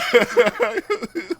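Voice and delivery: real high laugh at the punchline. Real, not acted. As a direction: burst out laughing. Host laughs at joke.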